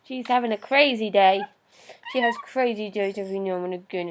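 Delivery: normal talking voice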